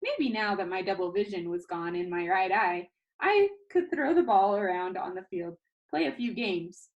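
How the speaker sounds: ordinary delivery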